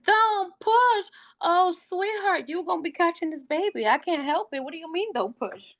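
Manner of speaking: high, mocking disbelief; incredulous. Incredulous retort to nurse.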